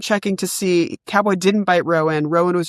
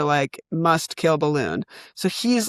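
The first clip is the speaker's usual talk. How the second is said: ordinary talk, nothing marked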